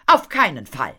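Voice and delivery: loud, emphatic refusal; bursting out. A narrator's reading. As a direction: blurt out a firm no. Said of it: "platzte es aus Madame Fatal heraus". (laut herausgeplatzt)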